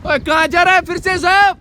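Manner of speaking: high, loud holler to a friend; loud, exasperated call across distance. Real, not acted. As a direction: shout after someone wandering off. Calls to friend wandering off.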